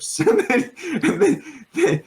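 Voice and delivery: laughing through the words; words broken by a high laugh. Real, not acted. Laughing at own joke.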